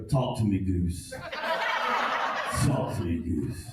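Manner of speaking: very low mock pilot voice; Top Gun quote. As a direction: drop deep like a movie fighter pilot. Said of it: "I quote lines from the Top Gun movie".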